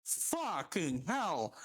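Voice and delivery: slow, low, drawn-out exasperation; exasperated. Retold or imitated. Inwardly reacting to the customer's overpriced drink, slow and exasperated. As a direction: drag it out in disbelief. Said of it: "And it's like".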